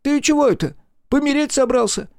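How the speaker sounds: very high, astonished questioning; surprised. A narrator's reading. Puzzled friend asks if he plans to die, surprised. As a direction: squeak it out in surprise. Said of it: "удивился Дрыстун".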